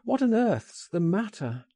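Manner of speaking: high, exclaimed question; urgent, baffled concern, pitched well up; alarmed cry. A narrator's reading. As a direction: cry out in concern without shouting. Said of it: "I cried".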